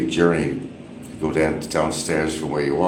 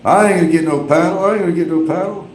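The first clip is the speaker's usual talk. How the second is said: high, fast mock protest; imitating Barry refusing the paddle, high and fast; 10 semitones higher, faster than usual. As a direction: rattle it off pitched way up. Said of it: "he goes".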